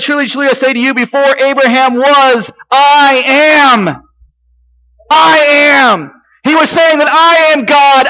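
high, loud, emphatic pulpit delivery; full-force preaching. Real, not acted. Preacher declaring Jesus's claim to be God, high and forceful. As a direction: drive each claim home to the congregation.

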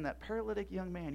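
normal talking voice

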